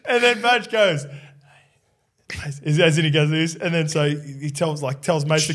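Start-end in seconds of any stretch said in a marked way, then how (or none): none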